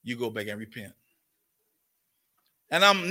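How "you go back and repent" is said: a soft, much lower voice of the Holy Ghost; Holy Ghost speaking. Retold or imitated. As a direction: lower and softer, a gentle inner command. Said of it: "Then the Holy Ghost speaking now".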